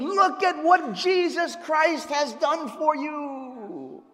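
ordinary talk

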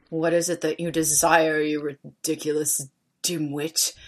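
cold, low, quiet contempt; Snape. Acted. Reader doing Snape's voice after prompting, cold low sneer. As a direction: demean them icily, softly and low. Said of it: "demeaned angrily in his cold voice".

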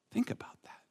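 breathy whisper, drawing them in; whispered aside. Acted. Preacher whispers to the congregation to think. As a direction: hush it to a whisper.

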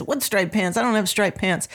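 usual speaking voice

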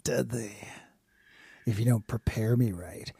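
low, hushed menace-flirt; quiet, hammed-up seductive threat; mock-sultry puffer fish. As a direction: murmur it deep and soft.

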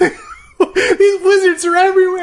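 comic high-pitched hysteria; mock panic. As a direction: wail a hammed-up paranoid warning. Mock panic about wizards.

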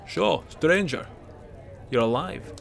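lightly voiced jovial old Nord; gruff Bracknell voice.

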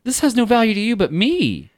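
high, slow, earnest animal voice; high mock dog voice. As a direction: play the dog lecturing Jerry. Voicing the wild dog, high.

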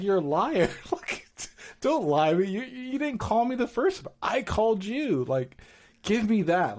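half-laughing mock outrage; playful accusing. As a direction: tease her for fibbing. Retold teasing spat with wife.

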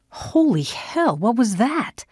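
high, swooping, drawn-out shock; jolted exclamation with the pitch leaping; startled. A narrator's reading. Father hearing a scream rip the air, startled.

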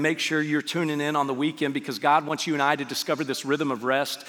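ordinary talk, nothing marked

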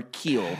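"keel" as a version of cool; variant of "kewl". Shown as a demonstration.

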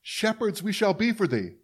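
high, put-on orator reciting a prayer; folksy politician impression. Politician reciting a movie prayer to the folks, folksy mock solemnity.

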